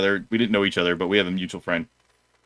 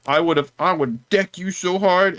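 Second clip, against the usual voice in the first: threatening customer; 5 semitones higher than usual. Retold or imitated. Customer threatens to deck the young employee, mock bluster. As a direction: play up an older customer's empty threat.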